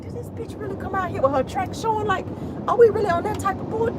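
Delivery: side-eye thought in your head; incredulous gossip aside; judgy inner voice. Retold or imitated. Inner thought while glancing at track.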